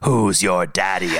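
low, slow oily creep voice; sleazy pickup line. Shown as a demonstration. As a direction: drawl it like a sleazy seducer. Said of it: "the sexy, oily guy voice".

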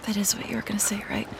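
soft whisper. Acted. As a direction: breathe it close to her ear. Intimate whisper.

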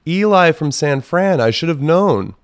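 mock cheerful text read aloud; mock sweet reply. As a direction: lay on fake sweetness. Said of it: "I said".

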